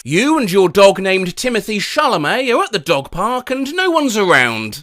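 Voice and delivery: normal talking voice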